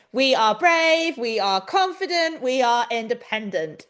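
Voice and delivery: slow, high, drawn-out affirmations; high sing-song mantra to a nervous horse; self-encouraging mantra. Retold or imitated. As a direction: coax yourself along slowly like a pep talk. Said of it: "I was walking along going".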